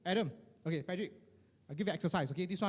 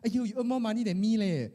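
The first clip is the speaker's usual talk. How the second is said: high, protesting modesty; hammed-up bashful denial; shying away from praise; 6 semitones higher than usual. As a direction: deflect the compliment straight back. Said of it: "We shy away".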